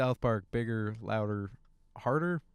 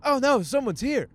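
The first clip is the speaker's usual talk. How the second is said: high, louder mock panic at a knock; gasping oh no, someone's at the door; panicked realisation; 10 semitones higher, 4 dB louder than usual. Caught on the toilet, alarmed at the knock, mock panic. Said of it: "I'm like".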